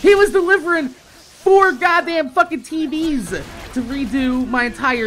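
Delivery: ordinary talk, nothing marked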